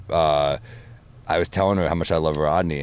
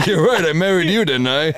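much higher and louder punchline; Rodney impression; 10 semitones higher, 4 dB louder than usual. Rodney insulting wife in movie, high and loud. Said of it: "back to school".

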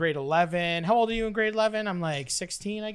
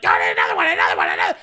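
loud, rough babbled shout; frantic mock begging; 14 semitones lower, 7 dB louder, much faster than usual. Boy begging the girl for another one, frantic shouted babble. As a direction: clamour wildly for more.

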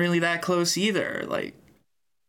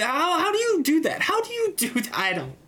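pitched way up in disbelief; how do you even do that, aghast; incredulous high rant; 11 semitones higher than usual. Real, not acted. Host ranting at Dana's comments, incredulous and high.

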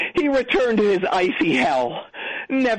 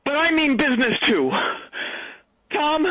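ordinary delivery

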